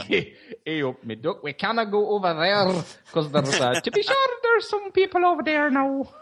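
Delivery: a hammed-up jumble of British and Irish accents; mixed-up accents. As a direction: swap accents mid-line, ending high and Irish. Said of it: "did all of those accents".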